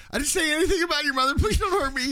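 high, loud, fast panicked squeal; mock Bobby voice. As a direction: squeak it out like a terrified mama's boy. Podcast hosts quoting movie scene.

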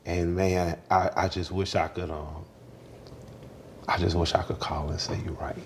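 low, hushed grief; soft, halting confession of regret; grieving regret. Real, not acted. Wishes to call dead mother.

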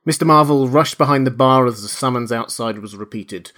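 ordinary delivery

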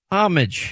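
homage said HAH-mij, stress up front. Shown as a demonstration. Host repeating the clip's version, homage as HAH-mij. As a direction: say homage with a light H and a j ending. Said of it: "With the H".

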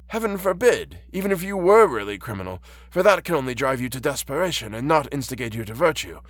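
put-on high-pitched, gentle old blind man; kindly old man. Reads the blind old man's part.

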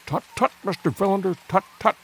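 fussy tut-tutting scholar; remonstrating old professor. Acted.

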